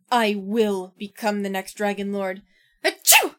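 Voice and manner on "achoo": loud fake sneeze; big high sneeze. Frost boss catches a cold.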